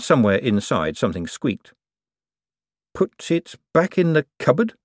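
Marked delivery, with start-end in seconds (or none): 2.9-4.8 uncertain. A narrator's reading. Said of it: "Moist suggested uncertainly".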